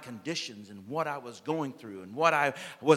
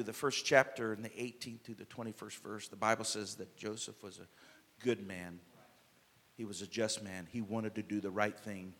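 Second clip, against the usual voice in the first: normal talking voice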